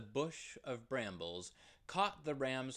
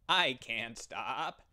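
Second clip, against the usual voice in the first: loud, slow cry; cried; 7 dB louder, slower than usual. Acted. The ram cries back to the fountain, slow and loud. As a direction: call it out resolute. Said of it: "cried the ram".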